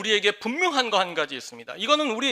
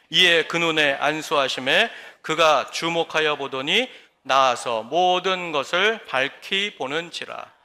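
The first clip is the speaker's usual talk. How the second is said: scripture reading; 5 semitones lower, slower than usual. Real, not acted. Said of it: "다 함께 읽겠습니다".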